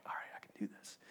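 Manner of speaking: barely audible whispered self-pep-talk; nervous whisper. Acted. Nervous dad talking himself into the run, whispering. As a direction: whisper it to yourself, very softly.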